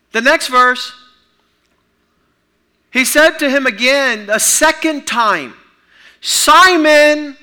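loud, high, slow preacher's cry; full-force preaching. Acted. Preacher voicing Jesus asking Peter, loud slow preaching. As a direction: proclaim each line like a call.

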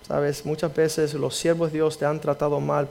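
usual speaking voice

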